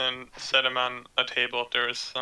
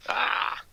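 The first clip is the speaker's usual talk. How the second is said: surprised cry; 10 semitones higher than usual. Real, not acted. Cry after the roll.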